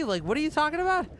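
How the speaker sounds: high, fast, baffled disbelief; can't believe what they just heard; rushed, squeaky what-are-you-on-about; incredulous. Real, not acted. Disbelief at no light beer.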